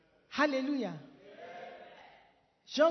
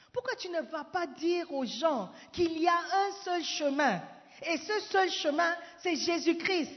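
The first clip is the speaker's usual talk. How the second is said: loud, higher urgent exhortation; fiery preaching; 5 semitones higher, 6 dB louder than usual. Acted. Preacher urging listeners to preach Christ, fiery. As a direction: press the congregation hard.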